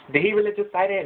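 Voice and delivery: can't believe the nerve; shocked disbelief. Acted. God asking Jesus, shocked by Lucifer's boast.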